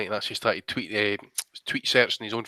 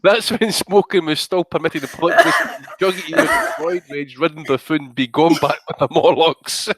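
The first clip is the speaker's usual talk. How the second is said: louder, giggling repeat of the insult; laughing through it; 4 semitones higher, 5 dB louder than usual. Real, not acted. Rereading the tweet to co-host, laughing loudly. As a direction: relish the insult while laughing.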